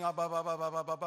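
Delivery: loud, rapid repeated syllables; loud mock babble, back and forth; imitated babbling. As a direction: mimic someone's empty babble of syllables. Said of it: "if you hear a person saying".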